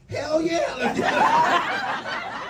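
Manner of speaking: high, loud, drawn-out whoop; excited salesman. Retold or imitated. Salesman cheering the cash sale, loud excited whoop.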